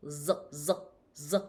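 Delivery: "Z" as a heavy, thick buzz; short, separate "z" sounds, thickened. Shown as a demonstration. Teacher shows how we pronounce the letter, thick Z. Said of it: "thick Z".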